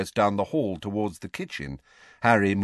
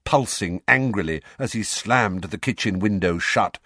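ordinary delivery, nothing marked

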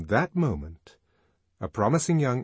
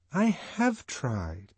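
slow, higher, insistent answer; aggrieved reply; 6 semitones higher, slower than usual. A narrator's reading. Lenina answering Fanny's challenge, aggrieved. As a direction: stretch it out in protest.

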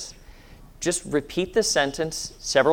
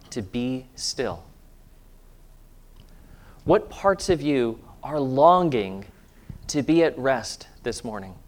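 guided meditation; 2 semitones lower, 3 dB quieter than usual. Acted. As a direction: gently guide them into stillness.

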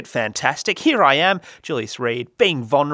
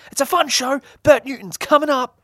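radio promo voice; 11 semitones higher, 4 dB louder than usual. Acted.

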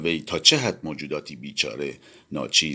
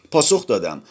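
ordinary delivery, nothing marked

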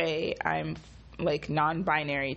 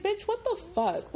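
high, laughing "what the fuck"; laughing disbelief. Real, not acted. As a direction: laugh through the outrage. Laughing exasperation.

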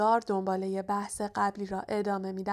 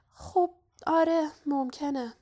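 higher, hesitant half-agreement; cautious; 6 semitones higher, 3 dB louder than usual. A narrator's reading. Hermione half-agrees with Ron's theory, cautiously. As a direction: concede it carefully, word by word. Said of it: "هرمایونی محتاطانه گفت".

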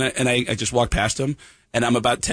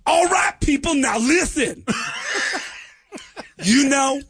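[name] stage voice; 8 semitones higher than usual. Retold or imitated. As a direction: belt it like a frontman working an arena. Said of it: "[name] does a rap".

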